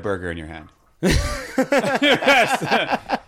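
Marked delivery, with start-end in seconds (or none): 1.0-3.2 talking through laughter; laughing. Real, not acted. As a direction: giggle through the line. Laughing about the burger.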